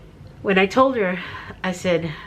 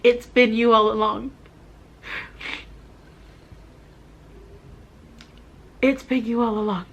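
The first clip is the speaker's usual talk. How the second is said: choked up, with long halting pauses; emotional, tearful; 3 semitones higher than usual. Real, not acted. Woman realizing God was there all along, tearful. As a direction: speak through real tears of awe. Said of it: "I don't wanna get emotional".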